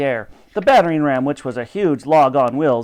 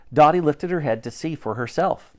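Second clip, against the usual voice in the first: ordinary delivery, nothing marked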